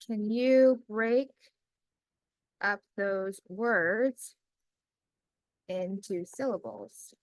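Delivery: slow, word by word, as if typing along; measured dictating voice with long pauses; slow dictation. Acted. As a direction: spell out each phrase for the computer. Dictating next prompt.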